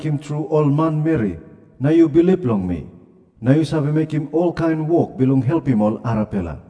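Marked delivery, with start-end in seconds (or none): none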